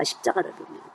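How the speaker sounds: breathy whispered repeat of 십자가를 보면; whispered echo. Shown as a demonstration. Preacher echoing the key verse to the congregation in a whisper. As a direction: let 십자가를 보면 fall to a hushed whisper.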